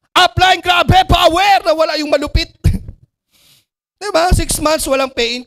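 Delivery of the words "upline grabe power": high, loud slogan shout; hammed-up hype for the upline; overexcited. Recruit months without pay-in answering upline, still overexcited. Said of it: "Naubos yung excitement level".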